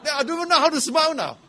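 loud, high, fast protest; loud comic bafflement; comic exasperation. Real, not acted. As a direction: throw up your hands in high-pitched mock helplessness. Joking exasperation amid audience laughter.

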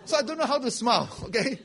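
loud, high, fast protest; loud comic bafflement; comic exasperation. Real, not acted. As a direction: throw up your hands in high-pitched mock helplessness. Joking exasperation amid audience laughter.

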